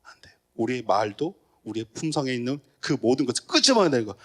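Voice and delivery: normal talking voice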